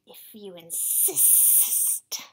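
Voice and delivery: hushed, slow and low; low slow whisper. Acted. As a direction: breathe it out slowly like a snake. Boa answers slowly.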